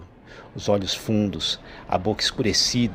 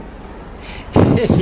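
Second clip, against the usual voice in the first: crazed cackle; broken mad laugh; 4 dB louder than usual. A narrator's reading. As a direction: laugh like a man losing his mind. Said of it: "um riso quebrado, louco".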